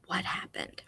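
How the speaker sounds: breathy whispered question. Shown as a demonstration. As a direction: whisper it to draw them in. Teacher prompts class in whisper.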